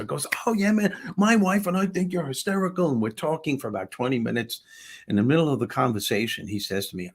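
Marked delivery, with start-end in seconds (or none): 0.5-2.9 imitated gushing fan, pitched high; excited fan. Seatmate telling comedian he and wife love him, excited fan. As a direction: gush like a delighted fan.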